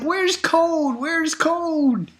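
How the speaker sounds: high, slow excited wail; fans crying out. Retold or imitated. Imitating fans calling for Koon, high and wailing. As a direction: chant it like a cheering crowd. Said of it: "Everybody's like".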